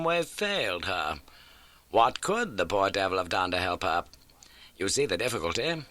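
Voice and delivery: crisp, keen detective laying out facts; Holmes voice. Acted. Holmes briskly telling Watson of the old case. Holmes reconstructs the lion attack.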